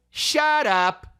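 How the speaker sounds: very high, stretched stage retort; high mock-fifties brush-off; overdone fifties shut up. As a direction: mimic the actor's hammy line. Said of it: "he did it like that".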